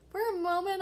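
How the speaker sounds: loud high burst on for a moment; exaggerated storytelling drama; dramatic. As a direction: build it up big. Father retelling dramatic moment.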